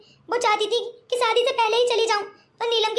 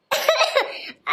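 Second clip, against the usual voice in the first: breathy laugh; 4 semitones higher than usual. Acted. Laughs before grandmother calls.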